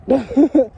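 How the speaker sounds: a quick real burst of laughter, loud; short laugh. Real, not acted. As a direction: laugh it off at yourself. Laughs at own spiral.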